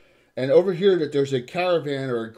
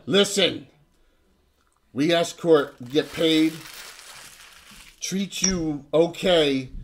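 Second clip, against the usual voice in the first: slow, broken brute speech, louder; bugbear guard; 4 semitones higher, 5 dB louder, slower than usual. Acted. Bugbear escort warning the prisoners, slow and menacing. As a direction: spell out the rules like a dim brute. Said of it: "And he says".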